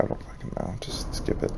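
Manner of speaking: unvoiced frustrated whisper; whispered aside. Real, not acted. Annoyed player whispering to himself, unvoiced. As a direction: mutter it under your breath.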